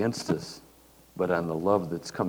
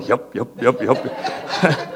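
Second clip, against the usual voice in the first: laughing, louder eager agreement; Doug agreeing; 3 semitones higher, 6 dB louder, much slower than usual. Retold or imitated. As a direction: chuckle through each yep like he's had enough. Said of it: "Doug is back there going".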